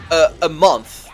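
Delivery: ordinary delivery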